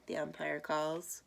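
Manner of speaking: ordinary delivery, nothing marked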